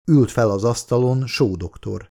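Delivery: ordinary delivery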